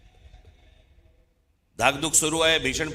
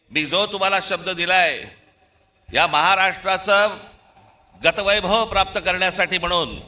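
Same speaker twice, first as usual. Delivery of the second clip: raised, forceful political oratory; fiery rally speech; 4 semitones higher, 3 dB louder than usual. Real, not acted. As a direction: rouse the crowd with conviction. Rally pledge to crowd.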